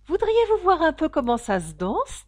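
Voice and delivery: loud, high-pitched invitation; eager offer. A narrator's reading. Fausse tortue propose la danse. (proposer avec entrain, voix haute)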